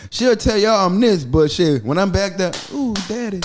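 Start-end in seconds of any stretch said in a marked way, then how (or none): 2.7-3.4 high breathy-free teasing coo. Retold or imitated. Mocking his girl calling him daddy in private, high and cooing.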